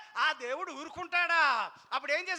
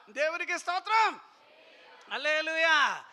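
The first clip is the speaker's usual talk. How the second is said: rousing shout; 6 dB louder than usual. Real, not acted. Preacher rousing congregation.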